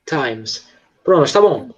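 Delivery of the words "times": "Times" with a puffed, breathy T; aspirated T. Shown as a demonstration. Teacher correcting a pupil's "Times", T aspirated. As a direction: release a puff of air on the T of "Times". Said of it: "esse T ... ele é meio soprado".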